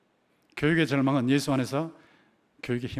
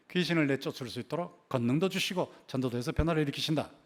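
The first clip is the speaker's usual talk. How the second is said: ordinary talk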